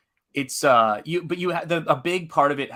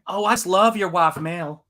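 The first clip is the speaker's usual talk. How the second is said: put-on Southern accent; 6 semitones higher, 4 dB louder than usual. Demonstrating how a Southerner would praise Mel, Southern accent. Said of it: "That's what it would be".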